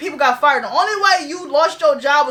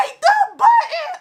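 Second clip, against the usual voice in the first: very high, drawn-out mock yell; high-pitched, slow imitated plea; imitated coworkers' outcry; slower than usual. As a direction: squeal it like frantic coworkers. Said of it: "They was like".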